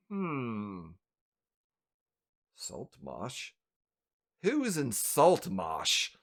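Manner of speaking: low, quiet, slow musing; pondering elf. Acted. Elf at his desk puzzling over a parcel, pondering. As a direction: puzzle over it slowly, half to yourself. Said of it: "elf behind the, the desk goes".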